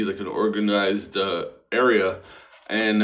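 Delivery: normal talking voice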